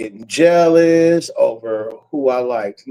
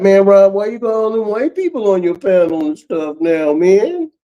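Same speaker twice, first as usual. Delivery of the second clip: mock complaining viewer; 7 semitones higher than usual. Imitating a viewer complaining to Rob about his panel, higher and whiny. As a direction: whine it at Rob like an outraged fan.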